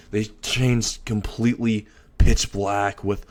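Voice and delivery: plain reading voice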